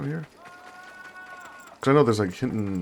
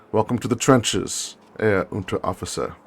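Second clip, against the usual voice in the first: lightly voiced lieutenant; 2 semitones higher, 8 dB louder than usual. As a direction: lightly play a commanding superior. Voices lieutenant welcoming officer.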